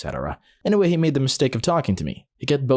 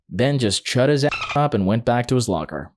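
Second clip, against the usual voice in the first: plain reading voice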